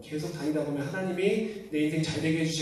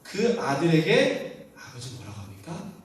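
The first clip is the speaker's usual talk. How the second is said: normal talking voice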